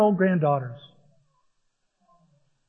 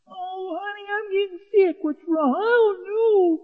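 whiny sick voice; 10 semitones higher, 4 dB quieter than usual. Acted. Acting sick husband and wife.

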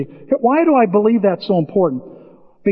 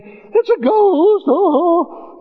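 high, slow mock panic; hammed-up high panic; mock fear; 12 semitones higher, much slower than usual. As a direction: shriek like frightened sailors. Disciples panicking in boat.

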